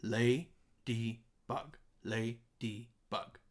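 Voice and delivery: LADYBUG split into three separate beats; separate syllables. Shown as a demonstration. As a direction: say Ladybug slowly, a gap after each syllable. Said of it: "three separate beats, three separate syllables".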